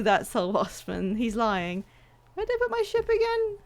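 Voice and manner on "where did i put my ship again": high-pitched. Pretend. Mock lost, high and fast.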